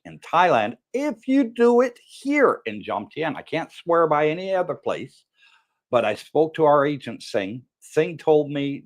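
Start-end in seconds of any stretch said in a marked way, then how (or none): none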